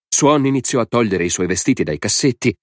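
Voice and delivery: plain reading voice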